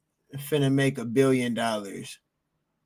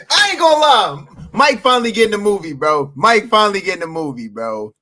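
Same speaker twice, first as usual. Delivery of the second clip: excited; 5 semitones higher, 8 dB louder than usual. Real, not acted. Hyped reaction to the trailer.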